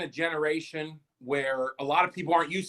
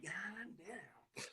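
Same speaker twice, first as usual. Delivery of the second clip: awed murmur; 25 dB quieter than usual. Retold or imitated. Ogling wife.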